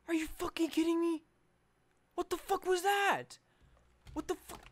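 stunned disbelief. Real, not acted. Disbelief after dying.

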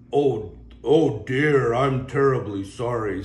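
big remorseful giant; apologetic whale. Acted. Whale apologizing to the pair, slow and sorry.

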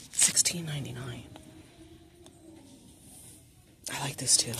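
whispering prices under her breath; a hushed aside to the camera while browsing. Real, not acted. Vlogger browsing a store shelf, whispering.

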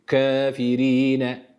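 Kafirine very slow, kef between e and a; slow Kafirine, fine ke; thin kef. Shown as a demonstration. Tutor models the thin kef. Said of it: "Kafirine değil".

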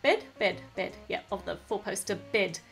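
usual speaking voice

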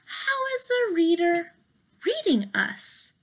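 ordinary delivery, nothing marked

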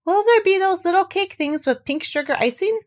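a hint of greedy hope; lightly voiced Pooh.

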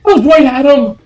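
loud, high outburst; shocked yell. Real, not acted. Player right next to the pursuer, shocked yell. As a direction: yell it in disbelief. Shocked after near encounter.

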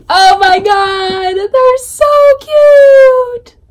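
very high, drawn-out squeal of delight; excited squeal. Real, not acted. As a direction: stretch each word into a rising squeal. Squealing over cute art.

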